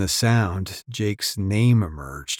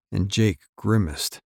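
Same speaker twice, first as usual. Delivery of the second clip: ordinary delivery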